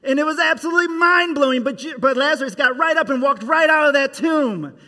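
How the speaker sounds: loud, high, fast narration; thrilled storyteller; excited. A narrator's reading. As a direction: burst with amazement.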